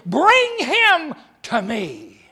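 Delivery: loud, very slow commanding call. Acted. Jesus commanding the crowd, loud and slow. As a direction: order it with full authority. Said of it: "And he commanded".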